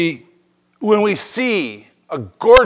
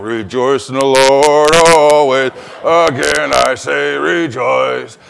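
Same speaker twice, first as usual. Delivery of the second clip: slow, lower mock-cheerful chant; grudging sing-song; 6 semitones lower, slower than usual. Driver in potholes, slow low grudging sing-song. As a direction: grit your teeth through the words. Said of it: "you're hitting potholes and ruts and you're going".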